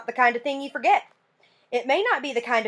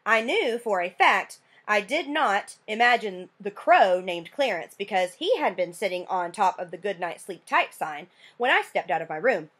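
usual speaking voice

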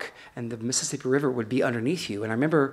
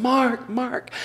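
mock hollering; 10 semitones higher than usual. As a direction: call out from far down the beach, half-acted. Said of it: "they were hollering".

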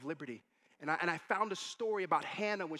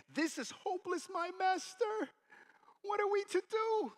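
high, wailing cry of despair; hammed-up helpless wail, pitched way up; panicked servant; 4 dB louder than usual. Servant to his master, surrounded by an army, panicked.

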